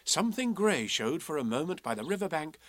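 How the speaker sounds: ordinary delivery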